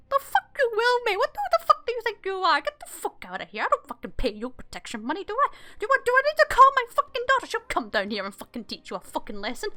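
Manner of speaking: high, loud outburst settling and flaring up; indignant old driver. Acted. Old guy refusing a guard's cart search, indignant high rant. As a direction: rant as a cantankerous old carter. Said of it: "And the guy goes".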